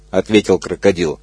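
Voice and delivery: ordinary delivery, nothing marked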